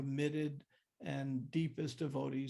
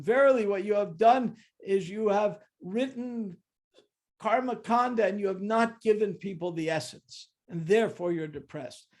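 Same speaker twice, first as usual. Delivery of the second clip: louder, higher proclaiming sage voice; solemn Narada Muni; 4 semitones higher, 9 dB louder than usual. Retold or imitated. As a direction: deliver the verdict like an ancient sage. Narada Muni pronouncing verdict.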